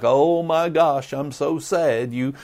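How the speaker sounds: hammed-up whine of a hurt cop; a hint of a weepy officer complaining; mock crying. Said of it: "crying like".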